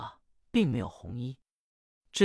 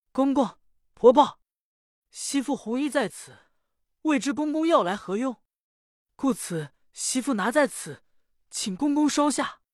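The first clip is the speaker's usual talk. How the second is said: put-on higher, humble young woman's voice; young daughter-in-law; 7 semitones higher than usual. Daughter-in-law presents the red garment to her father-in-law, humbly. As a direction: offer it up meekly like a dutiful daughter-in-law.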